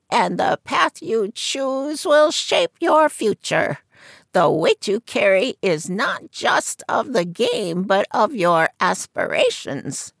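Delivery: put-on high croaky old seer's voice; croaking fortune teller. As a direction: croak it from behind a curtain. Madame Zora reading cards.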